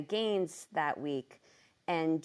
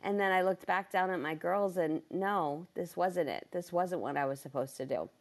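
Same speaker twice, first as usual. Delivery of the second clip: ordinary talk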